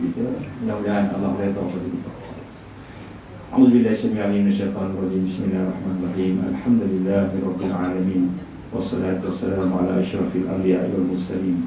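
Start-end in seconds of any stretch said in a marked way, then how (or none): 3.5-11.6 fast formulaic Arabic recitation; rapid Arabic recitation. Acted. Preacher opening his sermon formula, fast rote Arabic recitation. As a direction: rattle off the opening prayer quickly.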